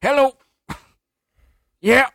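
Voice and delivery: high, loud Southern dad on a call; Southern dad impression. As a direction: holler short replies like a Southern dad. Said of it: "that one guy who does those Southern Dads".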